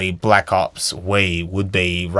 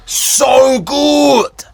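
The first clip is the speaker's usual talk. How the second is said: loud, high excited cry; excited shout; 14 semitones higher, 3 dB louder, much slower than usual. Real, not acted. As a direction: belt it out with joy. Excited shout about Bioshock.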